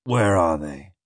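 low, menacing growled demand. A narrator's reading. Captain asking after his stolen papers, low growl. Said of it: "he growled".

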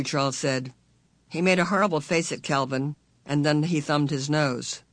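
usual speaking voice

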